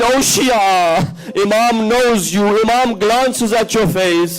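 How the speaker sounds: normal talking voice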